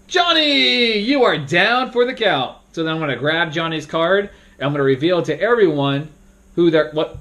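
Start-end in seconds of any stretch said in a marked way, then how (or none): 0.1-2.5 high, slow grand announcement; dramatic verdict. Shown as a demonstration. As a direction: deliver the death like a show host. Moderator announcing the death.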